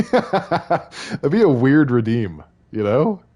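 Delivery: slowly, through real laughter; laughing. Real, not acted. Streamer laughing at a silly chat redeem, giggling through it. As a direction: let the giggles spill into the words. Laughing at chat's feet request.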